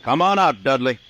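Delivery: calling out. Acted. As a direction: project the order across the yard. Marshal calls wounded gunman out.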